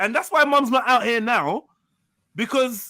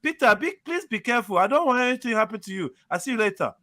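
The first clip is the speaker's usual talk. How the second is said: Ghanaian mum impression; 3 semitones higher than usual. Mocking a Ghanaian mother warning her son Peter, light Ghanaian accent. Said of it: "That's my best Ghanaian impression".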